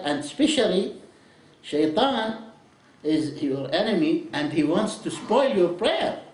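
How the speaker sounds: usual speaking voice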